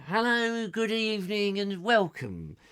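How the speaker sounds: slow, smooth TV host greeting; David Frost impression. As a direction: drawl it like a chat show host. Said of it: "go into David Frost".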